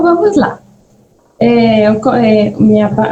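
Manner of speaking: ordinary talk, nothing marked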